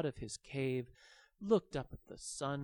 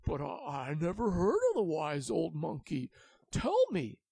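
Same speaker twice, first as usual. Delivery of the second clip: louder, pleading voice; eager dragon; 4 semitones higher, 8 dB louder than usual. Acted. The dragon begging the baby dragon to tell, loud and eager. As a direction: beg to know.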